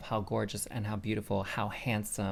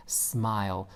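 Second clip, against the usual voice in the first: normal talking voice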